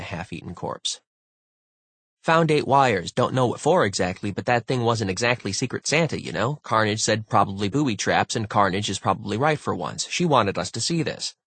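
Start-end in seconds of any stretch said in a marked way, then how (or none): 2.2-11.4 excitable, pushy report; animated crewmate reporting. Acted. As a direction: rattle off the findings with raised pitch. Voices Sengupta in story.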